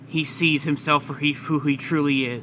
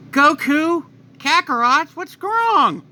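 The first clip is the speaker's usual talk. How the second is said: much higher, worried wifely voice; high Chi-Chi voice; 11 semitones higher, 5 dB louder, slower than usual. Acted. Wife calling to her transformed husband, high and worried. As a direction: call out anxiously in a high pitch.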